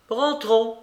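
put-on high, abrupt old-lady cry; cried command. A narrator's reading. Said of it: "cria la babouchka". (cri autoritaire de babouchka)

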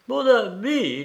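high, gushing address to a friend; effusive greeting. A narrator's reading. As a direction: greet him with delighted effusion. Baroness being hugged, gushing.

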